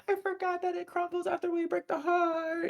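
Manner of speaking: high cartoon voice. Engine realizing the beast crumbles, high goofy voice. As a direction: pitch up into a goofy anime sidekick. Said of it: "And then Engine's like".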